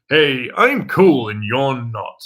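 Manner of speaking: slow, smug show-off voice; mocking the cool-guy act. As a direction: sneer it like the cocky kid. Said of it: "I just didn't like the".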